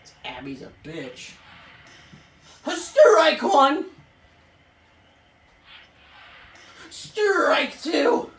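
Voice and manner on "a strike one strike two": drawn-out, much louder umpire shout; umpire call. Pretend. Pitcher's side calling strikes like a home-plate umpire, booming call. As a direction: bellow the strike like an ump.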